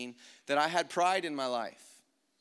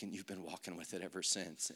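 low, soft voice of God; a hushed, lightly voiced Lord; the Lord speaking; 8 semitones lower, 11 dB quieter than usual. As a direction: speak quietly and low, as God gently confronting. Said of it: "He's like".

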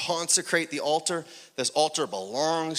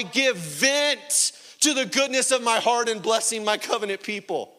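building proclamation; 5 semitones higher, 4 dB louder than usual. Acted. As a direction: build in waves into full-voiced preaching as God. Voices God promising to his people.

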